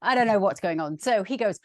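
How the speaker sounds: usual speaking voice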